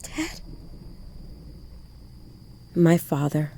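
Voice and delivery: ordinary delivery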